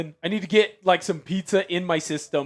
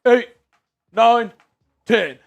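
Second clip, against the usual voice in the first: counting out loud mid-exercise; doing jumping jacks; 5 semitones higher, 4 dB louder, slower than usual. Real, not acted. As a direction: count reps while jumping. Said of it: "more jumping jacks for your boy".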